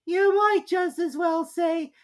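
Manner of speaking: very high, slow, drowsy squeak; sleepy Dormouse. A narrator's reading. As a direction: drone it half asleep. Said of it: "seemed to be talking in its sleep".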